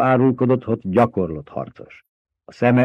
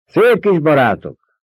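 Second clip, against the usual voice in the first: higher, louder, slower jeer; bitter jeering shout; sarcastic yell; 7 semitones higher, 5 dB louder, slower than usual. A narrator's reading. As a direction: throw the words back mockingly. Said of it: "kurjantott vissza valaki".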